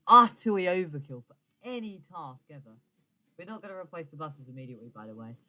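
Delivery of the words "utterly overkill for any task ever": emphatic. Real, not acted. Mock outrage at overkill train.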